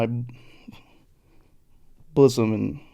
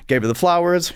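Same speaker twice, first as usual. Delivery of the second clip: ordinary talk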